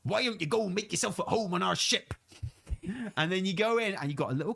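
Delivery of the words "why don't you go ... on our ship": lightly voiced pirate, hearty and welcoming; a hint of a jolly pirate captain. Pirate NPC welcoming the player aboard, lightly pirate-voiced.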